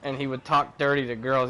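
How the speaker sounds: ordinary talk, nothing marked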